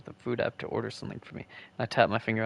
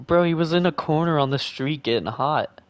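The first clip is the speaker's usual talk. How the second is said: excited; 5 semitones higher, 6 dB louder than usual. Real, not acted. Joking outburst about character.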